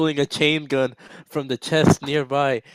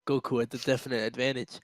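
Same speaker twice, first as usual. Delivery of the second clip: usual speaking voice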